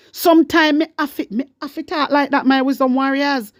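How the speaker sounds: usual speaking voice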